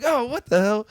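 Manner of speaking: high squeaky disbelief; shocked kid. Retold or imitated. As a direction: gasp it out like a stunned boy.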